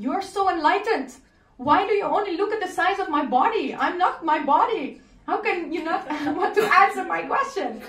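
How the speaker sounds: put-on high, loud childlike challenge; a small kid cheekily calling him out; bold little boy. The boy challenging the pundit, bold.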